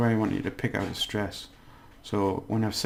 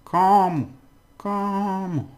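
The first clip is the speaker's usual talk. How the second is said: "Como" with a high, stretched first syllable; stress on CO; 9 semitones higher, 4 dB louder, slower than usual. Shown as a demonstration. Teacher says you have to do this, stressing CO in "Como". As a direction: exaggerate the CO of "Como", then fall. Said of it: "Right, you have to do this".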